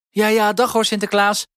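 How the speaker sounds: high, loud nervous goodbye; high Huispiet voice. A narrator's reading. Huispiet hurries Sinterklaas off, high and nervous. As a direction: pitch way up, eager to get rid of him.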